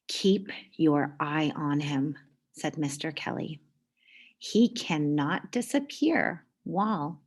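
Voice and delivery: lively storybook read-aloud; reading to children. A narrator's reading. Teacher reading a picture book to the class, lively. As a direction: read it like story time for kids.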